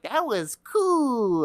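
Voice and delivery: put-on high, slow awed child; little kid voice. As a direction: drawl it out like a small boy. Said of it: "I was like".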